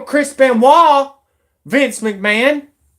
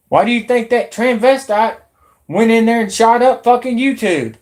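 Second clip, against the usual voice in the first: ordinary delivery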